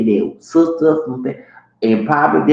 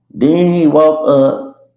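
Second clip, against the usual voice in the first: normal talking voice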